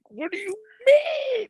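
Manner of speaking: imitating a wailing toddler, very high, slow and drawn out; mock crying shout of a kid who's been let down; whiny toddler wail.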